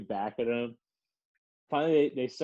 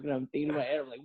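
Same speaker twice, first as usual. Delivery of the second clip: normal talking voice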